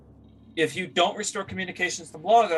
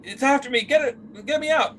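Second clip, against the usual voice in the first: high, frightened cries at the Joe; desperate to be pulled away; terrified woman begging to be saved; panicked pleading; 9 semitones higher than usual. Acted. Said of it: "It's like".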